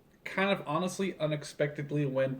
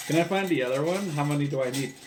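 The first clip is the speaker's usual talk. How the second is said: usual speaking voice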